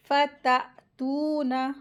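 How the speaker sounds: फततूना with doubled t and long held oo; measured recitation. Shown as a demonstration. Teacher models word, jolted double t, long oo in फततूना. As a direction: stop on the t of फततूना, then stretch oo. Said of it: "झटके से अदा करके पढ़ेंगे".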